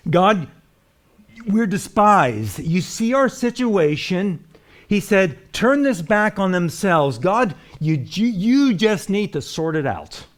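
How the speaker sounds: a hint of Nehemiah's pleading; preacher voicing a desperate prayer, mild plea. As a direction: call out to God, lightly voiced.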